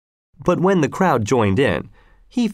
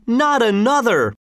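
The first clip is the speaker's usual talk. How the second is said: high-pitched group outcry; narrator's mock screaming crowd; 11 semitones higher than usual. A narrator's reading. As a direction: echo the cheer high and emphatic. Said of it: "screamed everybody".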